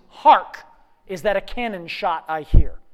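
loud HARK, then the question; reading a line. Acted. Agent gives the actor his line, declaimed. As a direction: give the line with a theatrical lead-off.

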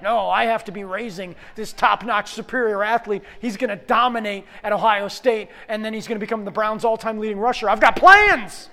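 loud, hammed-up indignant rant; overambitious dad rant. Dad planning his son's football glory, mock-frantic rant. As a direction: build to a high shout on the last words.

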